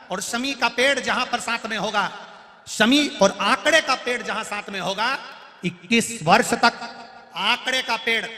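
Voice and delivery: fervent preaching. Acted. Preacher tells devotees the shami-mandar promise, in a high, loud voice. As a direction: preach it out to the crowd.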